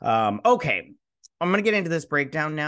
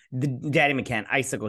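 ordinary talk

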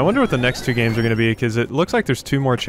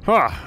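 mock villain scoff; 9 semitones higher than usual.

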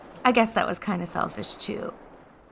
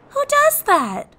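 incredulous; 9 dB louder, slower than usual. Real, not acted. Disbelief at eating old bread.

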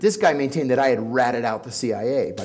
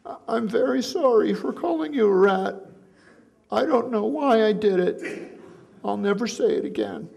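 meek apology; 7 semitones higher than usual. Retold or imitated. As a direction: sound small and cowed after the beating. Beaten man apologizing.

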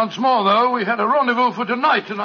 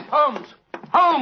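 urgent call; 4 semitones higher than usual. Acted. Watson finds body, calls out.

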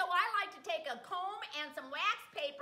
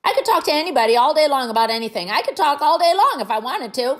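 loud boast; 2 semitones lower, 18 dB louder than usual. Acted. End clip over music, loud.

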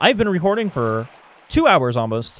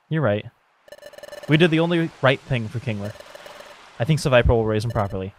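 low, subdued, sorrowful reading; downcast game character; 4 semitones lower, 5 dB quieter than usual. Acted. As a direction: play a friend quietly putting on a brave face. Voices sad characters the next morning.

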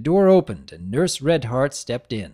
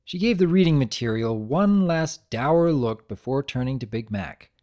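usual speaking voice

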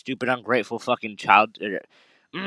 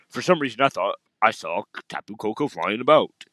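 lightly voiced old chief. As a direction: read the Kahuna's lines like a mildly gruff elder. Voices the Kahuna Hala.

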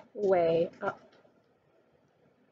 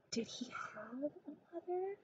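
hushed aside; 6 semitones higher, 16 dB quieter than usual. A narrator's reading.

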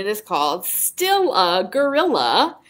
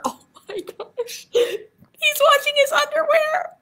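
breathy gasp into a very high squeal; giggly mock shock. Teacher reacting to gorilla washing underwear, mock shock. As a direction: squeal in scandalised, laughing disbelief.

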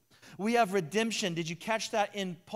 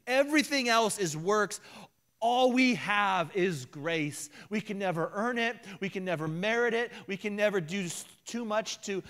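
loud, high preacher's climax; higher and louder, fervent sermon peak; preaching at full force; 6 semitones higher, 3 dB louder than usual. Real, not acted. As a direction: build it like an altar call.

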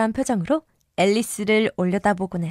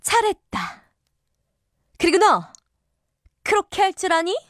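shouting queen; 8 semitones higher than usual. Acted. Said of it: "여왕이 소리쳤다".

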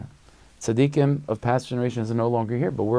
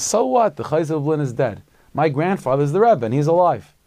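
put-on loud, high cocky comeback from a bold little boy; defiant retort; 6 semitones higher, 5 dB louder than usual. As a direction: toss it back with a child's brazen dismissal. Boy's defiant retort to old chassid.

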